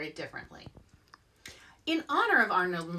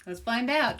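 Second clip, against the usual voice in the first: usual speaking voice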